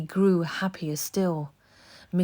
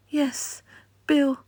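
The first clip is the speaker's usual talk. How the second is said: trembling; 10 semitones higher, 4 dB louder than usual. A narrator's reading.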